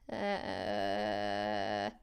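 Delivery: ultra low rumble; subharmonic voice. Shown as a demonstration. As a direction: drop into subharmonic growl. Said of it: "the subharmonic voice is so weird".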